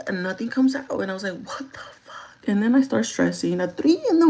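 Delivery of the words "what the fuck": slow hushed whisper. Retold or imitated. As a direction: breathe it out, stunned. Quoting own reaction at night.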